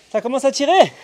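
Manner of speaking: high, loud strained cry; excited strain. Real, not acted. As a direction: let the burn show, loud and high. Coach feeling the strain.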